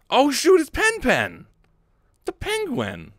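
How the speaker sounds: high, loud burst of surprise; excited recognition. Acted. Reader spotting Pen Pen in the comic, excited. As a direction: exclaim it high, easing off at the end.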